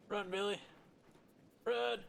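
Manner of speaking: high, urgent call to run; urgent cheering. Real, not acted. Streamer urging game character Billy to flee, high and urgent. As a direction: urge him on, pitch jumping up.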